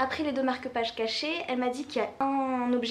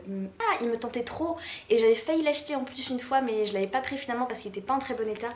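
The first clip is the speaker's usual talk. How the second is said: ordinary delivery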